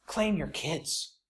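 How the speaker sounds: earnest low plea. Real, not acted. Urging dads to claim children.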